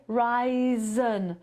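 usual speaking voice

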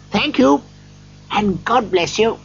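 high-pitched, heartfelt thanks; grateful Scrooge. A narrator's reading. Scrooge thanks the old gentleman, gladly. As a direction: bless him in a high, glad voice.

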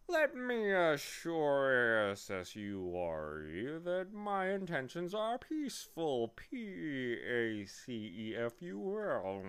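slow, swooping bee voice; spelling bee. A narrator's reading. Bee correcting Milo's fear, slow pompous creature voice. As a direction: spell it out with drawn-out, sliding letters.

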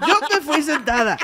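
high, loud burst of laughter while telling it; laughing hard. Real, not acted. Friend retelling a bus story, laughing loudly. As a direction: laugh out loud through the words.

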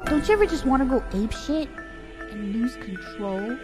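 ordinary talk

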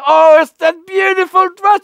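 high, loud, over-the-top gushing; gushing fake praise. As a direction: fake a delighted shout of praise.

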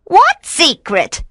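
high, drawn-out witch's question; high-pitched feigned ignorance; witch playing innocent. A narrator's reading. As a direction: ask it high, as if clueless.

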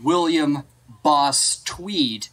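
usual speaking voice

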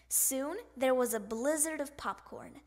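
ordinary delivery

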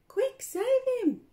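high, loud echo of the cry; excited call. Shown as a demonstration. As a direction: call it out playfully. Echoing the line.

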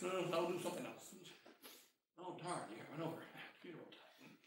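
very soft, low throwaway muttering; hushed, unbothered aside; mock indifference. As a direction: shrug it off under your breath. Acts out an indifferent, unloving parent.

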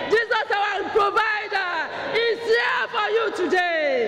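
preacher proclaiming Jesus to his audience, fervent; full-force preaching. Acted. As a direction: proclaim it like a revival call.

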